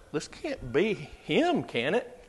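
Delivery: slow, hesitant doubtful question; cautious doubting question. Retold or imitated. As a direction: voice the crowd's wary hope, slowly. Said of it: "they say it in that kind of negative way".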